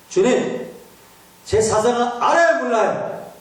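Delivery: high, slow pleading whine; Peter's high, drawn-out complaint to Jesus; Simon whining. Retold or imitated. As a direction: plead with the Lord to understand.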